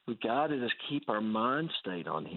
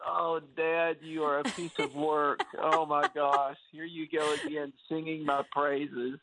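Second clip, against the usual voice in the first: mock exasperation; 5 semitones higher, slower than usual. Acting out exasperated reply to dad.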